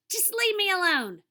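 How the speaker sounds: high, loud whining protest; exasperated yell. Acted. TV star rejects rescuers, exasperated yell. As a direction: yell them off, fed up.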